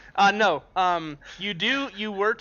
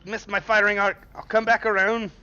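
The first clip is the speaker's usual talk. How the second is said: Scottish pilot; 5 semitones higher than usual. Acted. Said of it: "he's not Scottish, he's British".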